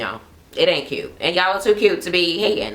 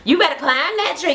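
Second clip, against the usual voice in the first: high-pitched, egging-on voice; mock cheering, pitched way up; hyped-up cheering; 11 semitones higher than usual. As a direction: imitate an excited friend hyping her up. Acting out hyped-up cheering reactions.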